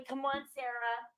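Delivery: imitating a calm plea; slow, gentle retelling. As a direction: ease the words out slowly, softly. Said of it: "He's very slowly going".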